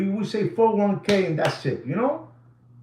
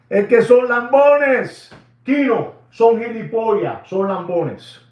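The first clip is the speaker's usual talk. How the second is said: loud, higher, slow insults in Spanish; angry outburst; 6 semitones higher, 8 dB louder than usual. Acted. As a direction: hurl the insults at the flatterers.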